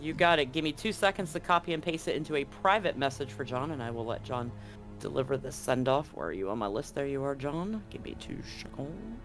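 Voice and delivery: usual speaking voice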